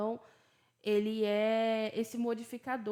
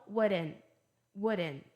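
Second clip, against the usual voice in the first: "wouldn't" American-style, T dropped; relaxed American "wouldn't" with silent T; American pronunciation; 2 semitones lower than usual. Shown as a demonstration. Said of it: "Tô dando as pronúncias British e American".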